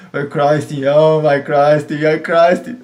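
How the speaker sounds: loud mock cheer of the wrong name; calling out. He cheers himself out of Burger King, loudly.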